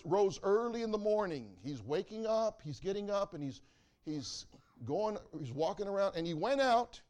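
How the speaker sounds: loud, ringing pulpit preaching that climbs as the story builds; preaching at full force. Acted. Preacher telling his church the Elisha story, loud and full. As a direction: preach it out big to the congregation.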